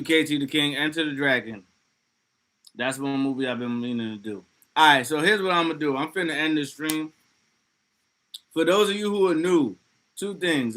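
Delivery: normal talking voice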